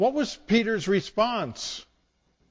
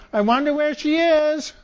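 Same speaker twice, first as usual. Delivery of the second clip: high pretend wondering, a bit louder; mock puzzled seeker; 10 semitones higher, 4 dB louder than usual. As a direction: play at not seeing her. Seeker pretends not to see her.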